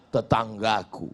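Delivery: low, soft reply; subdued client. Acted. Man naming his neighbour to the tuyul, low voice. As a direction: answer meekly, low and quiet.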